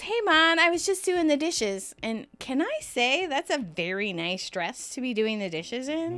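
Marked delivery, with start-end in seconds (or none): 0.0-2.0 fake casual. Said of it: "So Rachel goes".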